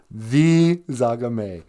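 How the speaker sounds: dry host confirming The Zagame; deadpan confirmation. Acted. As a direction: answer The Zagame. flatly and slowly.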